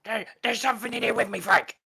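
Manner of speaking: high, urgent trapped pirate voice; panicked pirate. Acted. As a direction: plead for help from inside the gem.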